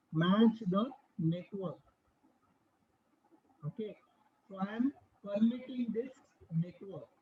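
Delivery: fading far off the mic; barely audible. Real, not acted. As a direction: voice drops almost to nothing. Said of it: "Your voice is very low".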